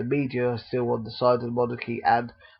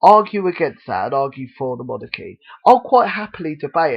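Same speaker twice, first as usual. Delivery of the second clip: ordinary delivery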